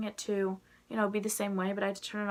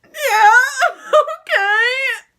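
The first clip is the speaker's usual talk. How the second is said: crying laugh; 16 dB louder, much slower than usual. Retold or imitated. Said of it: "And I'm like".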